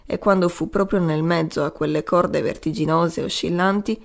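ordinary delivery, nothing marked

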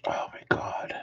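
breathy whispered aside; whispering. Real, not acted. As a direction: mutter it unvoiced.

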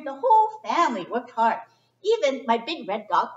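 usual speaking voice

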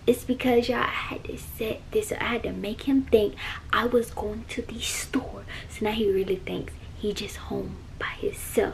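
soft unvoiced whisper. Real, not acted. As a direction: whisper from the closet. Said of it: "why I'm whispering".

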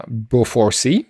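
ordinary talk, nothing marked

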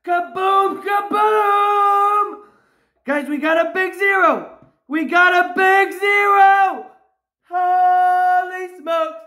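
real jubilant yelling, vowels stretched long; excited shouting. Real, not acted. Scratcher finds a big zero on the ticket, excited shouting.

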